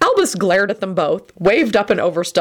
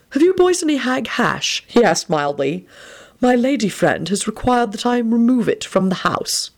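put-on old headmaster voice; 6 semitones higher than usual. A narrator's reading. Dumbledore asks for hash.

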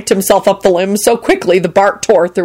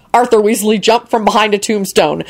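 ordinary delivery

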